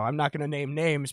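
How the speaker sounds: normal talking voice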